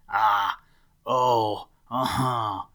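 loud spluttered cough-cries; acted coughing. A narrator's reading. Rabbit choking on peppery root, acted coughing. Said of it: "he coughed".

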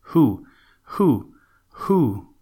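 loud, high owl hooting who. A narrator's reading. Great horned owl hooting as he thinks, loud owl calls. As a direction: hoot each who like an owl.

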